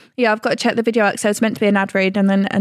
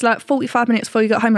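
normal talking voice

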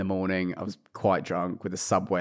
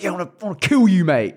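fast, high, louder mock aggression; mock threat; 9 semitones higher, 6 dB louder, faster than usual. Boxer threatening his opponent, mock aggressive. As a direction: rattle off a put-on hard-man threat.

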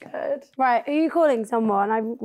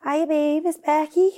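chirpy Liverpool accent, a bit higher; 4 semitones higher than usual. Shown as a demonstration. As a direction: say it like a Liverpool girl. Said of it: "I do a Liverpool accent".

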